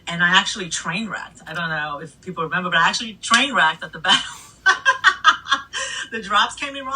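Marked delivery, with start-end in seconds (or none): 4.6-6.0 a burst of high laughter at herself; sheepish giggle at her own mistake; high embarrassed laugh. Real, not acted. Laughing at own train wreck.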